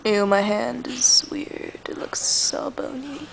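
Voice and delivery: hushed whisper. Real, not acted. Looking at her own hand, a grossed-out whisper.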